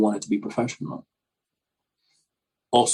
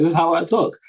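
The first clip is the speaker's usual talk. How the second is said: a lightly raised, polished corporate voice; meeting voice; 7 semitones higher than usual. Retold or imitated. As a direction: answer friends in your office meeting voice. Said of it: "Why are you talking like, you know, you're in a meeting?".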